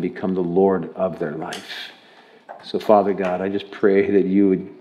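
earnest, subdued prayer voice; real, measured pastoral prayer. Real, not acted. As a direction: pray aloud, low and unhurried. Leads a prayer.

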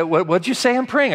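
high, loud offended what-did-you-say; indignant disbelief. Retold or imitated. Prayer leader stunned at being told to shut up, high indignant squawk. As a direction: sputter it back, outraged.

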